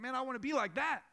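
inspired longing. Pretend. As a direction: burst out with admiring longing. Voicing an inspired listener.

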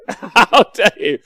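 How dare you laughed out, slow and high; play-offended through a laugh; laughing, mock-scandalised How dare you; laughing mock outrage.

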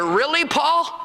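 mock doubt. Mock questioning of Paul.

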